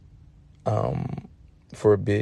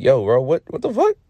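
baffled; 5 semitones higher, 6 dB louder than usual. Retold or imitated. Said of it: "Barney looks at him too".

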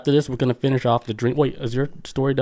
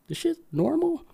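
high, shaky-sounding disbelief, voiced lightly; a squeaky, half-joking "is this normal"; startled high squeak; 5 dB quieter than usual. Shocked quote on the trailer.

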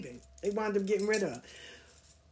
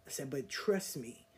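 quiet, low, cold threat; a low warning said through the teeth; low menacing threat; 5 semitones lower, 8 dB quieter than usual. Retold or imitated. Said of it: "But I said it in a, another way".

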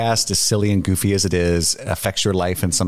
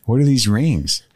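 stoner voice; 2 semitones higher, slower than usual. Pretend. Stoner voice in Lord of the Rings bit.

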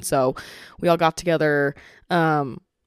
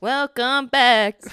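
drawn-out host voice; 5 semitones higher, 4 dB louder, much slower than usual. Pretend. Repeats drawn-out welcome.

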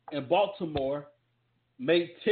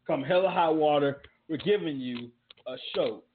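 ordinary talk

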